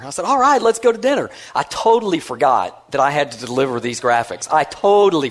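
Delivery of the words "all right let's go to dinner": eager agreement. Retold or imitated. Eager reply to buddy.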